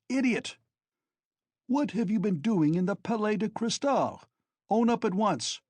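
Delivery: high, loud exasperated scolding; furious. A narrator's reading. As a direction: shout him down in disbelief. Razumihin furious at Raskolnikov.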